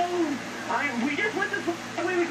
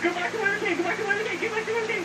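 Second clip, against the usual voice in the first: being chased; 6 semitones higher, faster than usual. Real, not acted. Urging escape while being chased.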